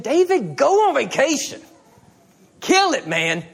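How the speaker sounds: a bright hint of celebrating a friend; cheering on.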